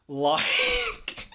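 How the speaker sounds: laughing hard, squeaky and high. Real, not acted. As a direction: break up into a high giggle. Bursts out laughing.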